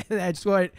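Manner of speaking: usual speaking voice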